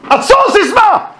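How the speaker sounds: high, sharp call at an approaching stranger; guard's challenge. Retold or imitated. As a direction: bark the challenge from the guard post. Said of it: "so I would say".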